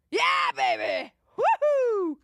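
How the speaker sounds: loud, whooping cheer. Real, not acted. Bettor whooping at the TV after a good play, loud cheer. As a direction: whoop at the screen.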